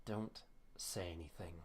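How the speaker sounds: hushed unvoiced whisper. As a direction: breathe it slow and secret. Whispered aside in scene.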